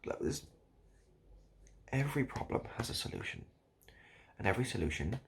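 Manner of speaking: ordinary talk, nothing marked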